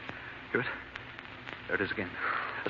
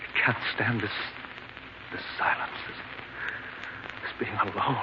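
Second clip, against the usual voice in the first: anguished murmur; 4 semitones lower, 9 dB quieter than usual. Acted. Lonely man breaking down.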